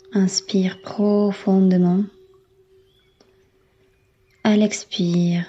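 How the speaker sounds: ordinary delivery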